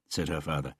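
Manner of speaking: ordinary delivery, nothing marked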